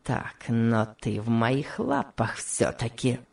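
slow, low, gloating menace; low villain's sneer; brutal sneer. A narrator's reading. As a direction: gloat slowly with a cruel smirk. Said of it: "сказал он со зверской усмешкой".

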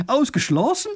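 high, loud flat-out denial; agitated insistence. A narrator's reading. Wife dismissing her husband's doubt, agitated. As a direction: reject it sharply, pitched right up. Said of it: "wird die Frau noch aufgeregter".

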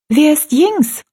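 put-on high, eager girl's question; high-pitched, animated curiosity; lively, curious. A narrator's reading. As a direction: ask brightly as a curious young girl. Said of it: "wurde ganz lebhaft".

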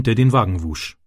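usual speaking voice

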